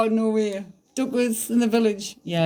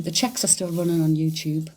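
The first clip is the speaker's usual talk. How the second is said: normal talking voice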